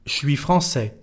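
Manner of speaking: "Je suis" fused into "chui" before "français"; casual "chui français" for "Je suis français"; spoken contraction. Shown as a demonstration. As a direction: contract "Je suis" to one sh syllable. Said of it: "when we speak".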